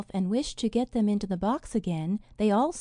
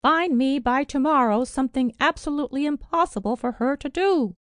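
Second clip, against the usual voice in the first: put-on louder, higher scolding old woman; reproachful demand; 4 semitones higher, 6 dB louder than usual. A narrator's reading. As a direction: scold the fairy with a harsh order. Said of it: "overwhelmed the fairy with reproaches".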